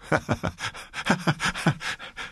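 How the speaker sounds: breathy, high nervous giggle; woman laughs nervously after a fright, breathy giggle. Acted. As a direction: laugh off the unease. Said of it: "Dann lachte sie nervös".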